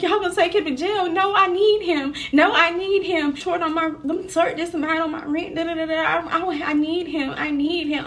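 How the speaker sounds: acted-out high, loud desperate pleading; retold crying plea. Woman begging police not to jail her partner, retold tearfully. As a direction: beg as if tearful, pitched well up. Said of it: "I'm crying".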